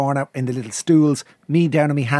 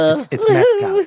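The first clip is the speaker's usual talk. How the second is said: giggling through the word; laughing; 6 dB louder than usual. Real, not acted. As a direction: laugh it out mid-sentence. Laughing at the memory.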